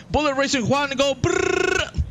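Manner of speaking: high-pitched call with a trilled brrr; playful hype call. Pretend. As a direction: cheer it out high, ending in a rolled engine trill. Playful engine noise catchphrase.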